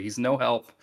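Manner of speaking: ordinary delivery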